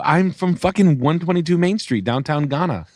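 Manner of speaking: high, emphatic retold comeback; mock insistence. Salesman joking to customers he's from Ghana, mock insistence. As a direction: insist with hammed-up indignation.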